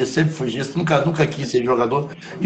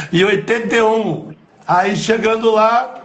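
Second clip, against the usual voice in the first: higher pitch, through leftover laughter; amused, laugh still in the voice; 7 semitones higher than usual. Real, not acted. Laughing after a joke about years.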